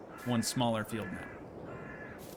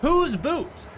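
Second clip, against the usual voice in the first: put-on Canadian accent; 14 semitones higher, 6 dB louder than usual. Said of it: "They would, they would say a boot".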